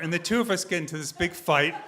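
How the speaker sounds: ordinary delivery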